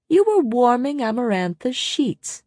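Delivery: high-pitched contemptuous sneer. A narrator's reading. Jurian goading Rhysand about Amarantha, sneering. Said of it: "Jurian sneered".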